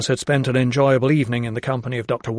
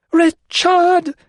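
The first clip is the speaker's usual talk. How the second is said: very high, loud scream of the name; desperate scream; 6 dB louder than usual. A narrator's reading. Lydia screaming for her son as they seize her. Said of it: "she repeated it in a scream".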